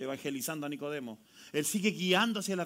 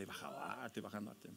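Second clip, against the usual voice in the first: soft aside to wife; 9 semitones lower, 16 dB quieter, faster than usual. Retold or imitated. Quoting himself to his wife.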